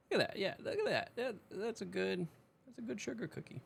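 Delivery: high voice. Acted.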